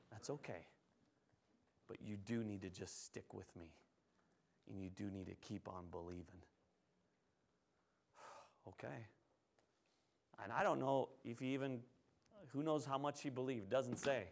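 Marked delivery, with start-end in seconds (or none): 0.1-9.1 hushed, low, soothing voice; quiet and tender, nearly under the breath; gentle reassurance. Acted. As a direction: comfort softly, without any pressure. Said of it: "so sensitive, so insightful".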